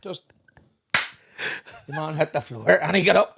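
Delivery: usual speaking voice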